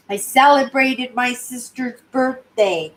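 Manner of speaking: ordinary delivery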